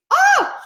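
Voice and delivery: very high, loud emotional "oh"; tearful "oh". Real, not acted. Woman needing tissues mid-story, high tearful outburst. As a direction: let out a high cry to shake off tears. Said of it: "oh, I need tissues".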